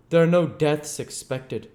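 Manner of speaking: cautious doctor probing gently; lightly voiced, careful questioner; a hint of wary Kemp. Kemp reassures.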